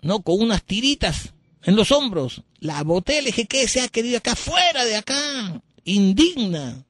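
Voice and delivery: high, shrill-free but lifted indignant telling; imitating a pompous priest; self-righteous priest. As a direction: boast of throwing her out.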